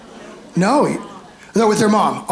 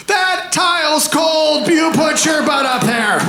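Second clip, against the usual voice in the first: wife complaining; 7 semitones higher than usual. Retold or imitated. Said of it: "I know her first comment".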